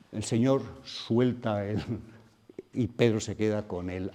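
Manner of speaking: usual speaking voice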